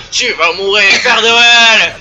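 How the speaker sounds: cri aigu d'assassin moqueur; assassin menaçant. Retold or imitated. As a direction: joue le tueur qui crie sa victime. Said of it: "il était comme". (cri menaçant imité)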